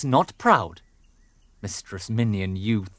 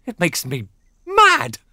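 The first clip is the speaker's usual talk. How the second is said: furious; 10 semitones higher than usual. Acted. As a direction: explode in rage. Father explodes in rage.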